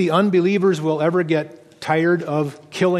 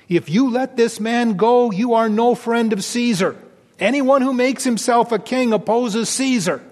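crowd shouting; 7 semitones higher than usual. Acted.

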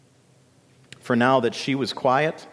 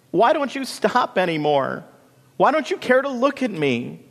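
high-pitched, wailing protest; screamed complaint; 11 semitones higher, 3 dB louder than usual. A narrator's reading. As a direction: plead indignantly, voice lifted high. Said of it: "screamed Marigold, trying to catch her colors".

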